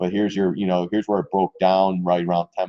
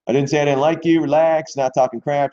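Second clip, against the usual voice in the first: defensive reassurance; 6 semitones higher, 4 dB louder than usual. Real, not acted. Answering an upset chat viewer.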